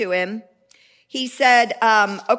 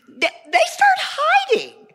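much higher, slow squeaky voice; mock squeak; 5 dB louder than usual. Preacher mocking Saul's soldiers who hid in caves, squeaky and much higher.

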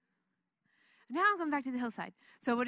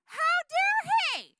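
much higher and louder, outraged squeal; indignant young David; 9 dB louder than usual. Acted. David is outraged by Goliath's taunts, high indignant squeal. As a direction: play David as an outraged upstart. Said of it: "David gets out there, and he's like".

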